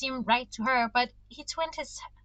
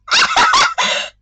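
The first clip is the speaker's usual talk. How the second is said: a squealing laugh much higher; high delighted laugh; 14 dB louder than usual. Real, not acted. Reader giggling at the confession, squealing laugh.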